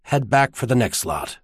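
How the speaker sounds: ordinary delivery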